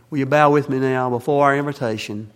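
normal talking voice

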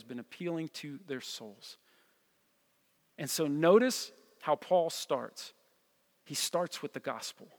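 low, hushed, grave sermon delivery; solemn preaching. Real, not acted. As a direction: quietly let each statement land, with long pauses.

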